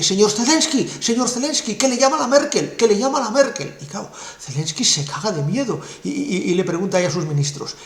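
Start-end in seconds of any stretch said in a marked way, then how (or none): 0.0-3.8 worried secretary. Retold or imitated. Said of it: "muy preocupada y le dice".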